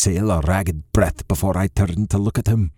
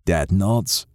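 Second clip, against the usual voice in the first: ordinary delivery, nothing marked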